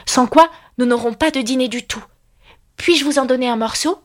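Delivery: brisk. A narrator's reading. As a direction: press the point politely. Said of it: "dit Alice vivement". (vivement, voix plus aiguë)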